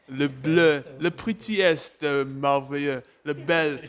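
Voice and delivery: playful fake French accent; jokey French accent, half-hearted; mock French accent. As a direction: toss in French words with a hammy French lilt. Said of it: "the French accent is, uh, coming through".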